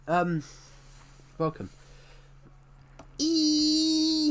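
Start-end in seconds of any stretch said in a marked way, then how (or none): 3.2-4.3 held high loud E; long high E. Pretend. Echoing comments saying E.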